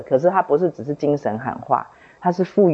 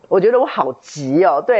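ordinary delivery

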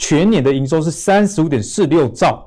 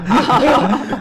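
high burst of laughter; 10 semitones higher than usual. Real, not acted. Host laughs at joke.

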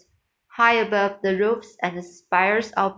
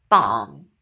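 low, loud mock drum boom; booming drum bang; 6 semitones lower, 5 dB louder than usual. A narrator's reading. Narrator voicing the drum struck by the clerk, low and loud. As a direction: boom it out like a bass drum hit. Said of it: "went the drum".

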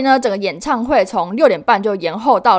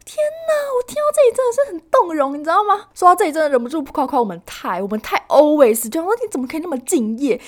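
high-pitched fangirl gush; moved, gushing; 7 semitones higher than usual. Real, not acted. Fan moved by her idol's dedication, gushing high in admiration.

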